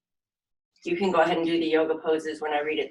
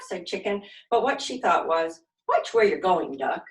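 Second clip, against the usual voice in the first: put-on lively, expressive read-aloud for little kids; animated children's story narration; storytime reading; 5 semitones higher, 4 dB louder, slower than usual. A narrator's reading. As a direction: read it like a silly picture book to a class. Reading picture book to children.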